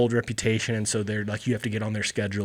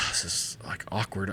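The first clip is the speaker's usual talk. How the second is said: low, hushed inner thought; awkward aside; 4 semitones lower, 11 dB quieter than usual. Retold or imitated. Customer caught at the front door, awkward aside. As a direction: drop it to an awkward murmur. Inner reaction to being accosted.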